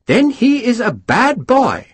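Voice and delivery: high and slow, relishing the gossip; happily. Acted. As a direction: savour each word with delight. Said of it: "Lord Pumphrey said happily".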